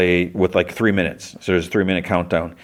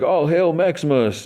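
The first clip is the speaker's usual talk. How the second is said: mock cheer; 9 semitones higher than usual. Soldier greeting Maximus on board, in a lightly voiced high cheer. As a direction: hail him like a rallying soldier.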